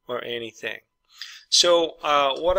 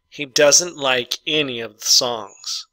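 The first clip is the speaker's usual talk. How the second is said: slow dictation reading; 3 dB louder, slower than usual. Shown as a demonstration. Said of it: "number five".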